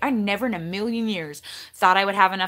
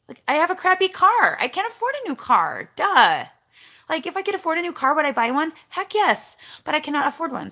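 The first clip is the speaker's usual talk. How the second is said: exasperated; 6 semitones higher than usual. Real, not acted. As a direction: vent it, fired up. Ranting about crappy car.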